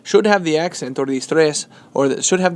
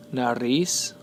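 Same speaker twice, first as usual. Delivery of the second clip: nariz stressed on RIZ; stress last syllable; 3 semitones lower, 4 dB quieter than usual. Shown as a demonstration. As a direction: lean on the final syllable of nariz. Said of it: "the stress is placed on the last syllable".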